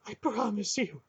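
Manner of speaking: high, frightened stammer. Acted. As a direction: stutter the promise in terror. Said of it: "he stammered".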